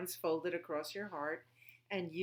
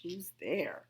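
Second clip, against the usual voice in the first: ordinary delivery, nothing marked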